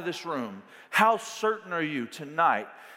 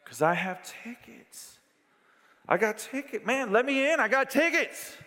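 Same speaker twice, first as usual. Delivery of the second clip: pleading fan; 9 semitones higher than usual. Pretend. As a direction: wave your tickets and beg to get in.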